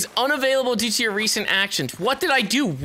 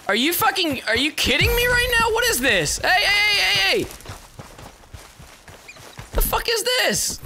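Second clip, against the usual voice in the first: high-pitched, incredulous outburst; exasperated yelling; 11 semitones higher than usual. Real, not acted. Player to a game character running away, exasperated yelling. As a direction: yell it high in sheer disbelief.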